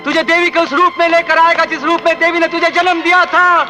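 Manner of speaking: defiant threat with climbing pitch; vengeful outburst. Acted. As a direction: swear revenge, building to a high-pitched cry. Enraged vow of revenge in film scene.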